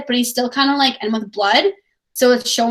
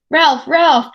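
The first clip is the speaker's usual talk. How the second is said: higher, louder urgent call; Piggy calling Ralph anxiously; 5 semitones higher, 5 dB louder than usual. Acted.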